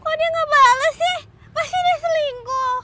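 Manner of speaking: high, loud fretting; agitated, high-pitched grumble; upset suspicion. Acted. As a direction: complain in rising agitation. Alone, suspects he is cheating.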